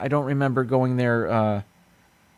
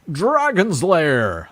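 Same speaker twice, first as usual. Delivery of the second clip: slow, higher-pitched drawn-out title call; game title voice; 5 semitones higher, much slower than usual. Retold or imitated. Host recalling an old arcade game, imitating its title voice. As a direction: draw it out like an arcade game intro.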